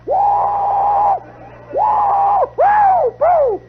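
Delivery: very high, piercing whooped whistle; imitated whistle blasts. Drum major signals the band to march, piercing whistle whoops.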